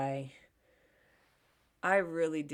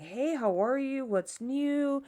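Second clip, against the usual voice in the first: higher-pitched imitated chummy hello; friendly greeting; 6 semitones higher, slower than usual. Guy comes up to her and her mom, retold chummy greeting. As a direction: mimic an eager acquaintance, pitched up. Retelling the same greeting again.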